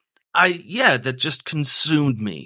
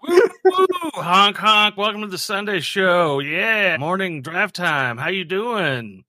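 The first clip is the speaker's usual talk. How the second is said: high, zany radio morning show host; goofy morning zoo crew energy; wacky morning DJ; 7 semitones higher than usual. Acted. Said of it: "wacky morning crew".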